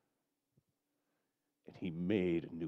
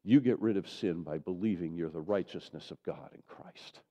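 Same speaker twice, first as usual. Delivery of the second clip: ordinary talk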